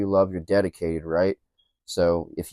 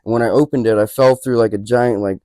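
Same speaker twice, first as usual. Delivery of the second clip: usual speaking voice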